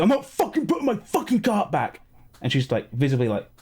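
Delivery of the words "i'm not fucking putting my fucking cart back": high, angry rant imitation; indignant high-pitched outburst; snapped. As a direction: mimic a man losing his temper. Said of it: "he just snapped and goes".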